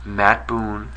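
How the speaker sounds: very low, soft admission; subdued, grudging statement; reluctant concession. Real, not acted. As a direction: concede it quietly into the camera.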